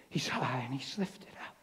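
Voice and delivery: soft whisper. Retold or imitated. Father comforting his son, whispered. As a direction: whisper it reverently.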